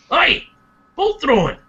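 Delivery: eager dwarf explaining a favourite sport; mildly excited, lively enthusiast. Acted. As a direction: share it like a keen fan. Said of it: "Durgan being so excited".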